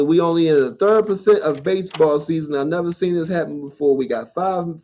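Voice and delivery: ordinary delivery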